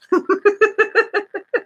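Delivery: long, high helpless giggle; giggling fit. Real, not acted. Cracks up over penguins.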